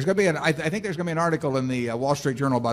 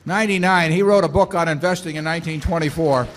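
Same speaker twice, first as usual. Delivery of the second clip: louder and higher, proudly presenting a guest to the hall; beaming, raised-voice announcement of a hero; excited introduction; 5 semitones higher, 7 dB louder than usual. Real, not acted. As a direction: call out his name to the crowd with delight. Introducing honored guest to crowd.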